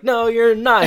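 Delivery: high, loud mock protest; insistent refusal. Boy stopping his friend from leaving, loud mock insistence. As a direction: imitate a kid flatly forbidding it. Retelling Corey refusing to let go.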